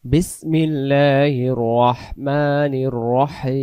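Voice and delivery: slow, measured Arabic recitation; Quran recitation. Shown as a demonstration. Teacher opening the lesson with slow recitation. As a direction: recite it slowly, drawing out each word.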